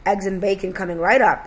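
usual speaking voice

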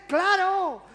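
high, loud, emphatic reply; exasperated father. Acted. Dad answering frightened child, loud high exasperation. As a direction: shout it as if it were obvious.

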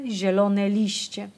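ordinary delivery, nothing marked

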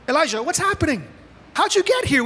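high-pitched, incredulous questioning; God questioning Elijah. Acted. God asks Elijah how he got there, acted puzzlement. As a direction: press him, pitch leaping up. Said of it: "God's saying".

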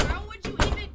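high, loud disbelieving shout; outraged shout. Acted. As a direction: yell it in disbelief. Outraged at toilet paper.

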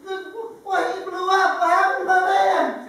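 shocked outcry. Acted. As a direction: yell in horror at the wreck. Man discovers his van blew up.

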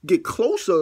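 outraged echo of a demand; incredulous repeat. Retold or imitated. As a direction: throw it back in disbelief. Mock outrage at caller's demand.

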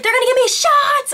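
high, loud re-enacted shriek; screaming and crying. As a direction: shriek in acted panic like a scared kid. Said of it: "So I started screaming and crying".

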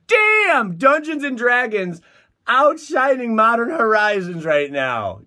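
high, loud, amazed celebration; thrilled. Real, not acted. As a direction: shout the win to the viewers.

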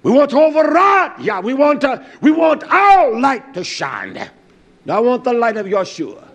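loud, high, impassioned sermon; rousing pulpit preaching; fiery preaching. Acted. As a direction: preach it out to the congregation. Preaching at full force.

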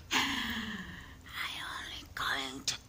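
deep, loud rasping demon whisper; low demonic whisper, slowing down on the kill. Acted. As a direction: hiss it out like the demon inside. This is a demon inside of you.

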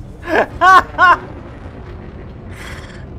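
high, loud cackle; laughing. Real, not acted. Delighted by his moustache remark, high loud laughter.

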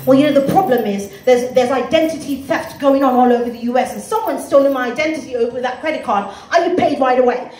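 put-on earnest British-accented excuse. British debtor blaming identity theft, British accent. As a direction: explain it smoothly in a British accent. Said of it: "he's got a British accent".

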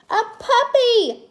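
very high, loud delighted squeal; shrieky child's excitement. A narrator's reading. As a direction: squeal it like a thrilled little girl. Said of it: "Cassie squealed".

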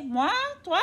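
ordinary delivery

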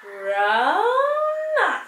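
"Rana" held long and high; drawn out read-along. Shown as a demonstration. Teacher reading the word with children, slow and sung. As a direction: stretch "Rana" like a chant. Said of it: "let us read it together".

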